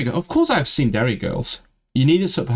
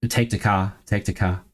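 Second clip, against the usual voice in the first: ordinary talk, nothing marked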